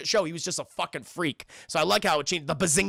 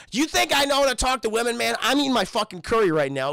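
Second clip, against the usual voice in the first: high, loud, indignant retort; acted annoyed outburst; irritated refusal; 5 semitones higher, 5 dB louder than usual. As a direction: push back exasperated, mock outrage. Sheldon irritably refuses to help.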